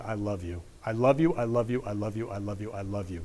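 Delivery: low, very soft repeated affirmation; tender. Real, not acted. Host telling everyone I love you, tender and hushed. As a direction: say it gently, almost to yourself. Repeating his loving phrase softly.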